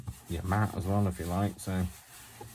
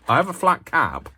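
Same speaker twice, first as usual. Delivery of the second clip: FLAT CAP pitched high and louder; emphatic admission; 9 semitones higher, 6 dB louder than usual. Real, not acted. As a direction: lift "flat cap" high, as the one true part. Corrects the flat cap stereotype.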